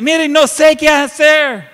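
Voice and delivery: high, slow, loud broken cry; despairing plea. Retold or imitated. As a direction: let the plea crack out of desperation. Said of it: "Y al final dice". (súplica desesperada y alta)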